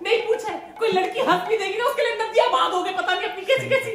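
high-pitched nagging mom; guilt-tripping Indian mom tirade; scolding mother. Acted. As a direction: rant like a long-suffering mother.

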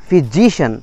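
"physician" with long JI, falling "shun"; correct stress. Shown as a demonstration. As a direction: stretch JI in "physician". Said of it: "একটা stress পড়লো".

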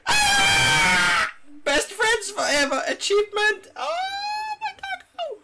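very high, loud squeal of joy; ecstatic squeal. Real, not acted. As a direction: shriek with delight at the new pet. Taming wolf achievement.

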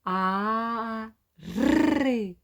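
А, then ри with a rolled р; vowel then ri. Shown as a demonstration. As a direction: join А to a rolled ри. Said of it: "Кажемо". (А, потім ри з розкотистим р)

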